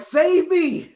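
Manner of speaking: high, loud squeaky plea for the dog; dog begging. Retold or imitated. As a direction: voice the scared dog begging to be saved. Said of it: "dog like".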